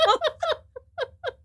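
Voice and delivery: high laugh. Real, not acted. Laughing at poop emoji tattoo.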